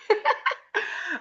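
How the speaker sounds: quiet high giggle; real giggle; soft high laugh. Real, not acted. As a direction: chuckle at your own joke. Laughing at own joke.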